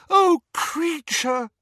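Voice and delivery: slow, high tearful cry; girl crying out in pity for a sobbing elf; wailing. Acted. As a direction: let the name break into a wail. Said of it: "wailed Hermione".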